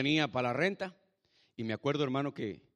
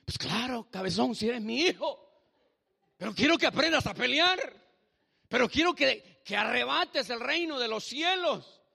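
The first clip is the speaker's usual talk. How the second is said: raised, high-pitched exhortation, acting God's reply; forceful fatherly rebuke; 8 semitones higher, 5 dB louder than usual. God answers his doubting son to fight, forceful fatherly rebuke. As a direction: build from scolding to a loud rallying cry.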